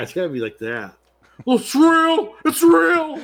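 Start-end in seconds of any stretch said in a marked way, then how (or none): 1.4-3.2 high, loud, shrieking mock protest; mock shout. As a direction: yell it like an outraged fan. Mocking horror fans, shouting.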